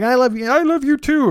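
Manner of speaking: high-pitched puppet frog voice; Kermit. Acted. Frog puppet answering a four-year-old kid, rough high-pitched impression. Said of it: "or however the fuck Kermit talks".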